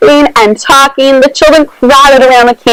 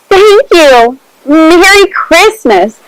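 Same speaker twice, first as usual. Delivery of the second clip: called out; 5 semitones higher, much slower than usual. A narrator's reading. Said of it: "called the children".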